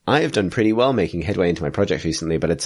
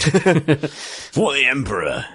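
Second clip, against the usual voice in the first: slow, high battle cry; 8 semitones higher, much slower than usual. Pretend. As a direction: bellow it like a Space Marine. Mock Space Marine battle cry.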